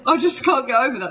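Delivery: high, loud and amused; can't get over it, voice pitched right up; creased up. Real, not acted. English speaker tickled by American pronunciation, high amused outburst. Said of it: "this one fucking creases me up".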